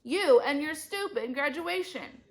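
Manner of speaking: high, loud scornful jab; angry shout. A narrator's reading. Mom quarrelling with rival mom, shouting. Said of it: "Andrea's mom shouted".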